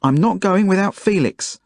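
high-pitched, insistent yell; yelled protest. A narrator's reading. Zelda resisting the man pulling her away, yelling. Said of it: "yelled Zelda".

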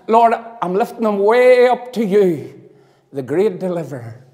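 booming revivalist preacher; preaching at full force. Acted. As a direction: drive it home from the pulpit. Rising preaching declaration.

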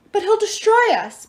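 high, loud dwarf wail; high-pitched dismay. A narrator's reading. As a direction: wail in fear and protest. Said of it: "Pasalos wailed".

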